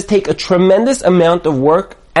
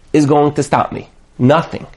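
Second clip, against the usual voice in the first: ordinary talk, nothing marked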